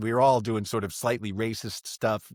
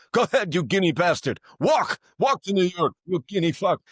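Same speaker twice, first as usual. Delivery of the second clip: higher, louder furious yelling; angry yelling; 6 semitones higher, 4 dB louder than usual. Retold or imitated. [name] yelling after [name] as he walks off, angry. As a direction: shout him out of the car. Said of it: "[name]'s yelling at him".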